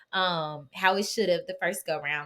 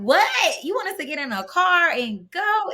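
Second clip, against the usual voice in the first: higher, louder retold disbelief, "you want us to go?"; shocked disbelief; 10 semitones higher, 6 dB louder than usual. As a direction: replay your stunned reaction to the news. Retold shock at doctor visit.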